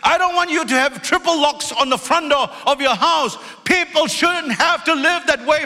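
high, driving sermon delivery; fiery preaching. Acted. Pastor preaching hard to his church, fiery. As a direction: preach it out to the congregation. Preaching at full force, raised pitch.

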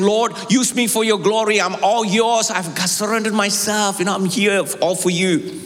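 crying out to God. Retold or imitated. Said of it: "you're crying out to God".

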